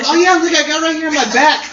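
high, girly, eager imitation; excited bartender. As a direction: mimic a thrilled girl showing off. Retold bartender showing her tattoo.

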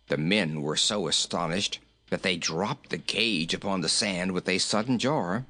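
ordinary delivery

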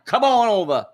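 high, loud Southern preacher; Southern accent. Pretend. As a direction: wail it like a mock Southern revivalist. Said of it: "It's a Southern accent".

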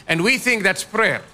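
ordinary delivery

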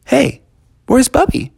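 high worried asking. Acted. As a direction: ask anxiously for a friend. Friends worried for Bubby.

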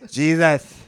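put-on slurred drunk exclamation. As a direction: say it like you're drunk. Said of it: "in, like, a drunk, like, accent".

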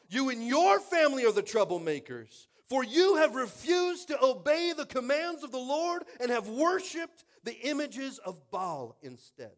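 shouted, high-pitched preacher's rebuke; acted accusation. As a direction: thunder it at the king, slowing as it builds. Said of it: "would like to be talked to that way".

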